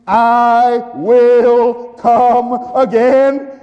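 high, loud, slow drawn-out cry; preaching climax. Real, not acted. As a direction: belt it out to the congregation. Preacher builds to shouting climax.

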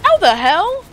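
high, loud disbelief swooping upward; baffled outcry. Real, not acted. Player stunned by what just happened in game, loud baffled outcry. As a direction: burst out loudly in real bafflement.

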